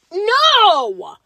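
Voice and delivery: finding ranch in quesadilla, indignant cry; outraged no. Real, not acted. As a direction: cry out in disgust. Discovering ranch in food.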